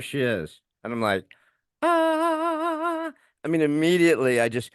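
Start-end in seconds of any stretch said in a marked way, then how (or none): 1.8-3.1 long, high held "ahhh"; a sustained high note of wonder; awestruck "ah". Retold or imitated. Man seeing his future wife, high awestruck "ahhh". Struck on first seeing her.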